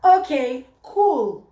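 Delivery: high, loud, falsely breezy okay; fake okay. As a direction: pretend it's fine while clearly not. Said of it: "I'd be like".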